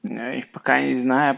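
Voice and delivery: ordinary talk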